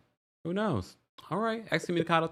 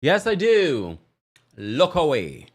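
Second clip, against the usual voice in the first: proud, louder, in a put-on British accent; mock British accent; 4 semitones higher, 8 dB louder, much slower than usual. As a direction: playfully show off a new British accent. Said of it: "he's got a new accent".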